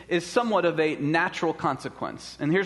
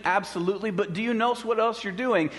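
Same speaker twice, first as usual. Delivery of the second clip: ordinary delivery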